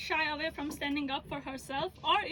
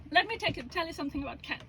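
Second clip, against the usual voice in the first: normal talking voice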